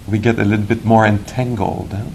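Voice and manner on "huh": ordinary talk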